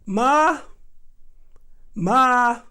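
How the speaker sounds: loud call of Ma; calling for mama. Retold or imitated. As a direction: call out to mom in the next room. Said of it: "that kinda went like this".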